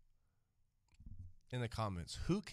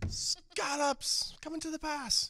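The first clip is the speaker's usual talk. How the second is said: slow, much higher mock chef call; Gordon Ramsay; 4 dB louder than usual. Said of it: "Do y'all know the name Gordon Ramsay?".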